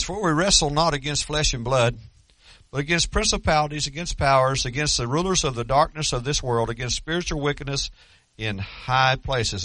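loud, declaiming Bible reading; scripture reading. Acted. Preacher reading Ephesians to his church, loud and declaiming. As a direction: proclaim the verse to the church.

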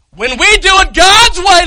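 When we do it God's way shouted high and loud, God's punched; preaching shout. Real, not acted. Preacher repeating his point to the congregation in a shout. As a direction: hammer God's way from the pulpit. Repeats line with full force.

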